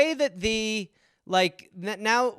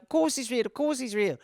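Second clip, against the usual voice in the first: quick soft brush-off, higher; placating mother; 5 semitones higher, 6 dB quieter than usual. Retold or imitated. Mother answering her pestering kid, placating. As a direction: fob the child off quietly. Mother fobbing off a badgering child.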